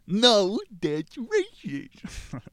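very high, loud, then drawn-out slow scolding; a prissy scold clutching pearls; hammed-up shocked reprimand; mock scold.

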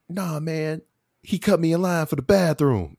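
imitating the drunk's whiny excuse; drunk guy complaint. Quoting the drunk guy's complaint.

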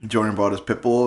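ordinary talk, nothing marked

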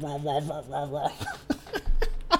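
quick repeated munching rah sounds; mock gator attack, half laughing; alligator chomping noises. Retelling a movie's gator attack to cohost, mock chomping.

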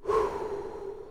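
long exhaled sigh; winded sigh after a long stretch of talk; catching breath. Real, not acted. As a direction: blow the air out to catch your breath. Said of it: "Let me catch my breath".

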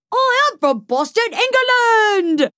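very high mock English accent, last word drawn out; hammed-up British accent. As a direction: squeal it in a fake British accent. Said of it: "stuck in that accent for the whole interview".

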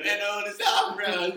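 loud echo. Real, not acted. Excited echo of prank.

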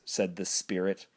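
normal talking voice

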